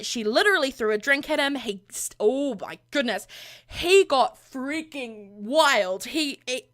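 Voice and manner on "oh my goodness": ordinary talk, nothing marked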